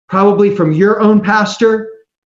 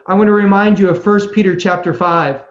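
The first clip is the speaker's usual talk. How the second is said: ordinary delivery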